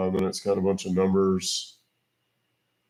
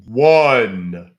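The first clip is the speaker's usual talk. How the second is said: loud dictating; 9 dB louder than usual. Real, not acted. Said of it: "Can you repeat again".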